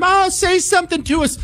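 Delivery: very high pleading fan voice; imitating begging fans. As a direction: beg up at the balcony like a fan. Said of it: "all his fans were underneath him".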